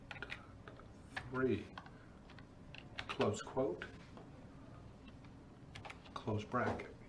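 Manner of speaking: slow word-by-word dictation while typing; dictating. Real, not acted. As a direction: read the command out piece by piece. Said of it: "we're gonna type this command".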